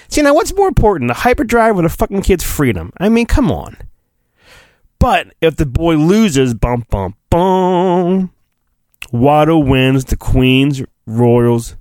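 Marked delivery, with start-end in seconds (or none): 0.1-3.8 indignant aside. Real, not acted. Indignant aside about the bet. 6.5-8.3 dum dum dum. Acted. Dramatic suspense sting.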